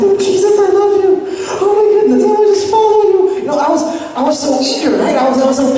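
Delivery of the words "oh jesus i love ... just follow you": put-on gushing child. Boy tells Jesus he loves him, high and gushing. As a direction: pour out a seven-year-old's tearful love for Jesus. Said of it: "I was like".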